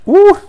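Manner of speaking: high, loud "ooh!"; a sudden high-pitched whoop of delight; excited whoop. Real, not acted. Vlogger discovering the last parcel of the day, excited whoop.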